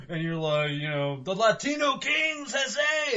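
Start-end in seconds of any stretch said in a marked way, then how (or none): 1.2-3.2 high, slow shouted war cry; mock gang shout. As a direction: bellow the gang's name. Voicing rival gang reply.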